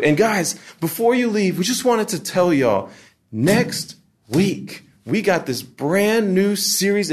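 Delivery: high, over-excited announcement voice; animated church emcee. Retold or imitated. Emcee plugging next week's series, hammed-up animated hype. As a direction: hype it up like a fake-peppy host. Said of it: "this is what it then turns into".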